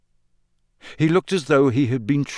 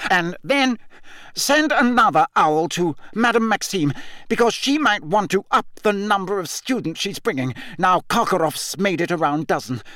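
high, raving official, climbing as he repeats himself; Mister Crouch; 8 semitones higher than usual. A narrator's reading. Deranged official ordering an absent assistant, high and agitated. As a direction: dictate busily, then get stuck and rise higher. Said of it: "muttering soundlessly at it".

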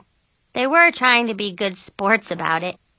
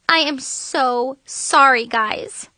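slow, higher apology; regretful, drawn-out delivery; muttered apology; 5 semitones higher, 3 dB louder, slower than usual. A narrator's reading. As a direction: draw out the apology in dismay. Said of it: "I muttered".

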